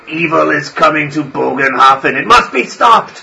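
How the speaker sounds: put-on an old priest declaring doom; solemn old priest. Priest proclaims.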